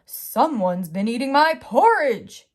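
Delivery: loud, growled bear voice; big bear discovering his porridge eaten; growling Daddy Bear. Acted. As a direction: growl it like an angry papa bear. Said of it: "he growled".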